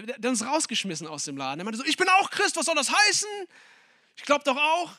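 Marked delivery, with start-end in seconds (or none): 1.8-4.9 loud, high-pitched indignant outburst; deeply offended. Acted. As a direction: act out wounded protest at full voice. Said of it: "das hat ihn so verletzt".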